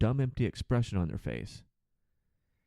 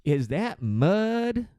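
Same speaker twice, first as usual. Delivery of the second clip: slow, high, drawn-out clueless question; dumb passenger; 9 semitones higher, 3 dB louder, much slower than usual. Retold or imitated. A flight attendant mimics a confused passenger, slow and dopey. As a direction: stretch it out like a baffled hillbilly. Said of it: "He goes".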